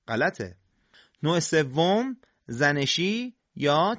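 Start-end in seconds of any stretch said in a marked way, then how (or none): none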